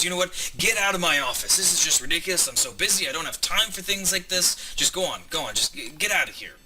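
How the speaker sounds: acted annoyance, building then easing off; upset talent agent. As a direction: throw them out in a fed-up huff. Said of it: "he's quite upset".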